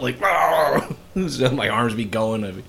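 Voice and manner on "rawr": grumpy rawr. Retold or imitated. Waking up like a beast.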